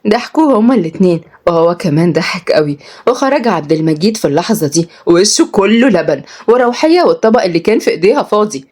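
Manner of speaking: usual speaking voice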